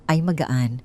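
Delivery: ordinary delivery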